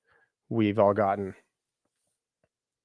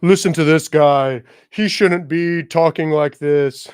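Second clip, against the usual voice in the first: mocking stuffy professionals; 6 semitones higher, 8 dB louder, slower than usual. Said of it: "the people in the profession then were like".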